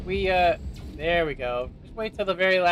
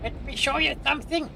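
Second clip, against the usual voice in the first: mock commanding shout; 8 semitones higher than usual. Streamer yelling at enemies, a high mock military shout. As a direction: bark it like a cartoon officer. Barking orders at game enemies.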